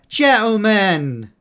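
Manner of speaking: loud, high, drawn-out mock entrance call; hammed-up villain announcing himself, high and loud; Cobblepot. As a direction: belt it out slowly, imitating a showy villain's greeting. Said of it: "Cobblepot showed up out of the blue and went".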